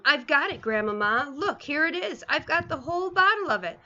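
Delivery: excited. A narrator's reading. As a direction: show off the prize, bouncing with joy. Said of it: "jumping up and down".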